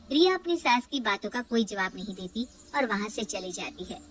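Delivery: plain reading voice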